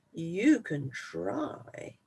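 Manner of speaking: unbothered, daring him; calm dare. Acted. Biggest goat daring the troll, very slow.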